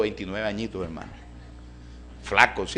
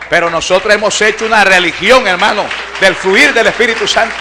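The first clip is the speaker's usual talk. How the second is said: loud, high Pentecostal exhortation; fervent preacher's cry, pitch and volume up; full-force preaching; 7 semitones higher, 15 dB louder than usual. Real, not acted. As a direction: shout it out to the whole congregation.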